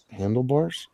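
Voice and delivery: Handlebars? asked with a rising, puzzled tune; Handlebars? echoed with a questioning lift. Shown as a demonstration. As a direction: repeat Handlebars? as if not getting the joke yet. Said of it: "Oh, I get it".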